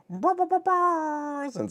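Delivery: high squeaky slowed effect voice; silly sound effect voice. Pretend. Jokey high sound effect voice to make up for no soundboard. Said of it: "I'll still do the stupid".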